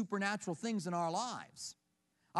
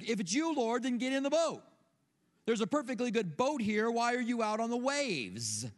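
insistent calling out; 3 semitones higher, 3 dB louder than usual. Acted. Frightened disciple to Jesus out on the waves, insistent call. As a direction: plead loudly, as if across the water.

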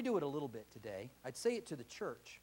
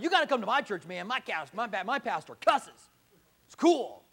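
mock churchgoer; 6 semitones higher, 11 dB louder than usual. Acted churchgoer inviting friends.

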